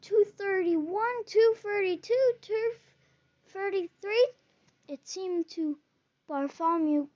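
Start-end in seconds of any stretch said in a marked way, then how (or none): 0.0-4.3 counting stairs. A narrator's reading. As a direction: count each step with growing dread. Counting steps down into dungeon.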